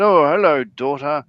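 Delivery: a mock-grand hello from a pompous king; Monty Python king. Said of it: "a Monty Python skit".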